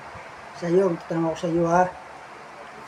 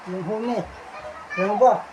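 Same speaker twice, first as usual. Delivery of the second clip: ordinary talk, nothing marked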